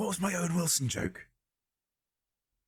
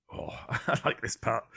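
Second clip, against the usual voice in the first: ordinary delivery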